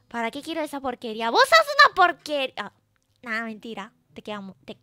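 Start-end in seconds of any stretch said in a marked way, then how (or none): 1.3-2.5 loud, fast mock insult with a higher pitch; mock outburst. Streamer playfully insults her chat, mock outburst. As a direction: throw it out as a playful, louder insult.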